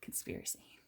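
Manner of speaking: mock conspiratorial whisper. Parent whispering to daughter about the book, playful whisper. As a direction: lean in and whisper it.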